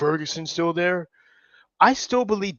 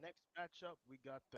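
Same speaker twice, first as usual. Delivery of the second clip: choppy breaking-up call audio; glitchy robotic call; 4 semitones higher, 30 dB quieter, faster than usual. Real, not acted. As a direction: voice cutting out on a bad connection. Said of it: "your mo- audio is breaking up".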